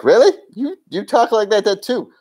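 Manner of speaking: acted high-pitched disbelief; playful squeaky astonishment; mock incredulous. Retold mock-incredulous reply in skit.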